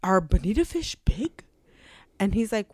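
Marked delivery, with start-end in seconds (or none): 0.0-1.4 unvoiced whisper of the question. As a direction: breathe the question out with no voice. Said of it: "Dale interrupts him to ask".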